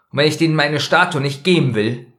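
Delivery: mildly angry defiance. A narrator's reading. Lois refusing to give up her statue, mildly angry. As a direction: push back with a touch of anger. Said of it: "Louise war richtig böse".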